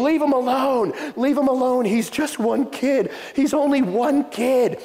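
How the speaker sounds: high, drawn-out pleading cry; imitated crying plea. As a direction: act out a desperate kid begging a mob.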